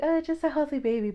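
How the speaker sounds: high, quick stock reply; put-on polite answer. Mother answering nosy askers, put-on polite. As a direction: toss it off high and fast, as imitated politeness.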